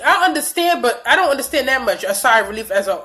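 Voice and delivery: ordinary delivery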